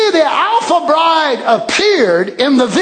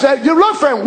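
ordinary delivery

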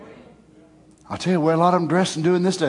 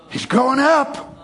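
higher, slow, delighted announcement; proud father exclaiming; 6 semitones higher, slower than usual. Acted. God watching his boy forgive, proud exclamation. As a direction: crow slowly over how he has grown.

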